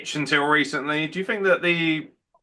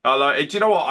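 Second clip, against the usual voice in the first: ordinary delivery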